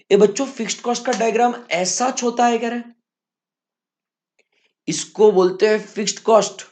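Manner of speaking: usual speaking voice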